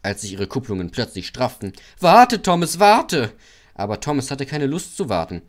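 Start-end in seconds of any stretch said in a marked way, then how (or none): 2.0-3.3 high, loud plea to wait; calling out. A narrator's reading. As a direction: shout after the engine. Trucks call after Thomas.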